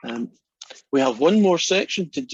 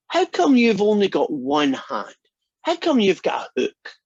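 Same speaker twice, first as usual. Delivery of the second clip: put-on louder, higher, eager child asking; 6 semitones higher, 5 dB louder than usual. The little boy quizzes the pirate, louder and eager. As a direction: blurt it out like a curious little boy.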